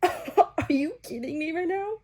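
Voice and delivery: giddy laugh, high and slow; laughing disbelief. Real, not acted. Swooning over book line.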